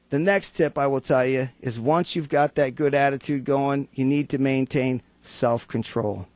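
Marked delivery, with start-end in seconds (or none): none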